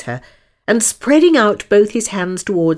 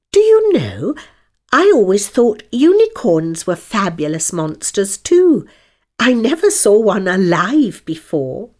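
put-on girl voice; 4 semitones higher, 4 dB louder than usual. A narrator's reading. Alice voiced in a story.

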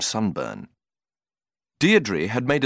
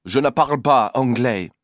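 French woman; 4 dB louder than usual. Acted. French woman answers Cyril.

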